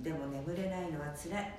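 normal talking voice

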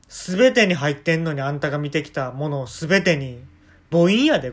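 loud, heated scolding, building to near shouting; angry rant. Acted. Dog owner loudly scolds partner for failing to guess, furious. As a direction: berate them for not guessing the name. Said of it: "怒っちゃって".

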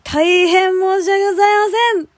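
high, loud, theatrical apology; over-the-top formal apology. Shown as a demonstration. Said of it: "ていう感じで言った方がいいですかね".

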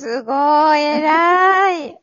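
excited praise. Real, not acted. Praising friend's workouts.

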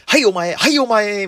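high, loud, pointing-out schoolboy jeer; cocky schoolboy. Retold or imitated. Schoolboy blaming a classmate for the broken vase, loud and cocky. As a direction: blame a classmate like a smug kid. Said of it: "いちびってる男子みたいな".

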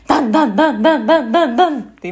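rhythmic loud thuds, ダンダンダン; bouncing thud noises down the steps; thudding sound effect. Retold or imitated. A woman mimicking her fall downstairs with loud thuds.

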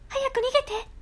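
put-on high girlish cry; girl's line. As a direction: squeal it like a frightened young girl. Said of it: "あの女の子の「早く逃げて」".

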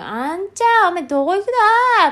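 high, loud drawn-out dialect call. Acted. Elder hailing a young man about where he's going, loud dialect call. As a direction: holler it in broad rural dialect.

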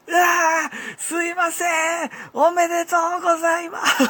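high, loud mock apology yelled out; imitating a coworker's loud panicked apology; retold yell. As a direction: shout over the blunder to cover it. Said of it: "って叫んでた".

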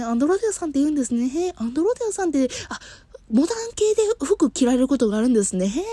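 high, quick, gushing clerk; chatty clerk. Retold or imitated. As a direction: echo every answer with fake interest. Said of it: "みたいな感じで".

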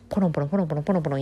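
rapid-fire piano-note imitation; imitated piano playing. Host describing skilled street pianists, rapidly imitated piano notes. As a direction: rattle off the notes like fingers racing over keys.

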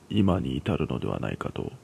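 plain reading voice

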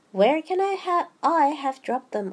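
high, loud worried rabbit; storybook rabbit in a mild fluster. A narrator's reading. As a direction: fret aloud over something lost. Rabbit worries about lost things.

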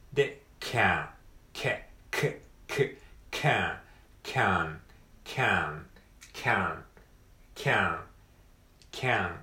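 can with the ae vowel, mouth set for え; can vowel drill. Shown as a demonstration. Teacher drilling the vowel in can. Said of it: "えの口をして、あっていう風に言う".

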